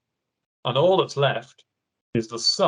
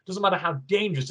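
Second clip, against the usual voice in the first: ordinary talk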